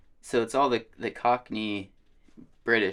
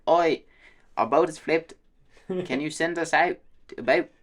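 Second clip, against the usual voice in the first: higher, louder mock Australian radio call; Australian accent; 7 semitones higher, 3 dB louder than usual. Stranded boater radios the Coast Guard, mock Australian accent. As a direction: imitate an Aussie calling in on the radio. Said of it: "how different Australians are".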